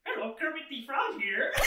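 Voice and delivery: lightly voiced Kermit the Frog; a hint of Kermit's voice; imitating Kermit. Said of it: "her, uh, impression was".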